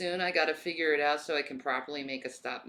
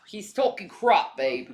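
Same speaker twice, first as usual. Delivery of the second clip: a lightly put-on British accent, a touch louder; playful British accent; 5 semitones higher, 4 dB louder than usual. Teasing her co-host about England, in a light put-on British accent. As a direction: tease him in a British voice. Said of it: "I just like doing accents".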